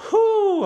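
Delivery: high, loud whew of relief; exaggerated relief, acted out in a retelling; relieved whew. Retold relief after risky joke.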